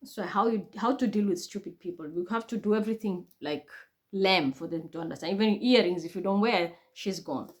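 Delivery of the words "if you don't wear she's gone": usual speaking voice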